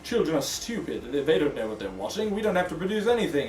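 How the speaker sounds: put-on executive's accent. Executive up there judging child viewers, in a put-on accent. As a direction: put on a self-important executive's low accent. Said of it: "I'm good at that accent".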